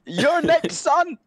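mocking sing-song taunt. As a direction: gloat at the accused. Taunting the voted-out player.

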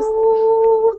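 high, drawn-out celebratory woo; excited woo. Real, not acted. Host cheers the viewers tuning in, excited woo.

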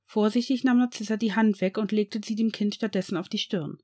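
usual speaking voice

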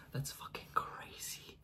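awed whisper. Real, not acted. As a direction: whisper it in disbelief. Whispered amazed aside.

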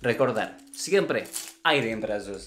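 Russian ballet teacher speaking broken Spanish; thick Russian accent in Spanish; acento ruso. Acted. (maestra de ballet con acento ruso)

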